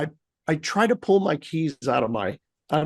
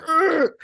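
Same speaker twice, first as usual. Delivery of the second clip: frustrated argh; 11 semitones higher than usual. Retold or imitated. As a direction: vent frustration in a short argh. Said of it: "I'm like".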